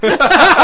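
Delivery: high burst of laughter; laughing at the concert story; loud laugh. Real, not acted. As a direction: let out a loud cackle.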